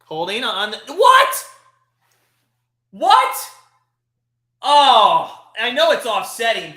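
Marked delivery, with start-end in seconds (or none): 0.9-3.4 shrieking disbelief, much higher and louder; can't believe the flag; stunned what. Real, not acted. Fan reacting to a penalty flag, stunned shriek. 4.6-6.7 exasperated outburst. Real, not acted. Angry at the officials' call.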